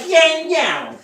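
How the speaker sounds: a Southern TV cook greeting her fans; Paula Deen impression. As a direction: ham up Paula Deen talking through her nose. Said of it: "That's how she talks, with her nose".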